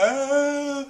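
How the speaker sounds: high-pitched fake wail; imitated tearful cry; mock crying. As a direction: wail it like a hammed-up sob. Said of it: "like, crying".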